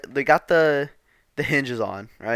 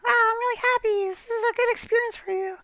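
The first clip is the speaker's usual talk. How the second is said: much higher sweet mock mom voice. Mom praising the finished door, high sweet voice. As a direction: chirp it like a delighted mother. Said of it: "She was like".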